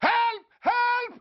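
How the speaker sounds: high-pitched, theatrical wailing for rescue; mock cry for help. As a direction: play the victim, calling out in a high voice. Loudly feigns being attacked, calls help.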